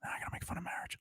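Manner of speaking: scheming whisper to yourself; very soft whispered thought; hushed aside. Acted. Secret inner thought, same skit.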